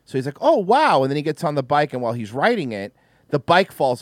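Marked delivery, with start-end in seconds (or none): 0.4-1.0 amazed Bart. Retold or imitated. Bart sees shiny bike.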